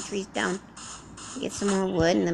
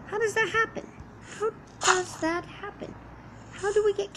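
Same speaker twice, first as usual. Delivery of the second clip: high, incredulous question; puzzled disbelief; 10 semitones higher than usual. Real, not acted. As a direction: act baffled in a high voice. Puzzled about cows on island.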